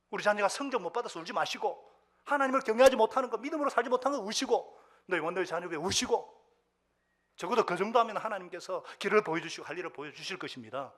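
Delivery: loud, driving pulpit exhortation; impassioned sermon climax; full-force preaching. Real, not acted.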